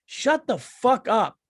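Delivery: slow, drawn-out shut the fuck up, pitched high; angry retort. Retold or imitated. Comic snapping back at fans, slow and exasperated. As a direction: drag it out in mock outrage. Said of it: "And I'm going like".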